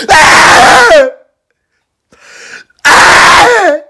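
high, loud wailing sobs; crying. Acted. Boy sobbing loudly after being kicked out. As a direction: wail and sob at the top of the voice. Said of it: "Yeah, I was crying".

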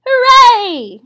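high loud hooray; cheering. Acted. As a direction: cheer it out together. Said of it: "cried everybody".

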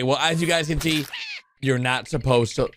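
ordinary talk

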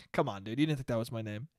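low, quiet, unconvinced reply; low-key disbelief. Acted. Man doubting someone who called him Fredo, low-key disbelief. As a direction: brush it off softly, pitched low. Acted confrontation over the nickname.

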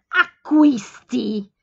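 acquisti shrieked loud and drawn out slowly; Acquisti! as an indignant slow shriek. A narrator's reading. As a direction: shriek the word in outrage, stretching it. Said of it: "Strillò Marta".

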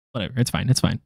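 ordinary talk

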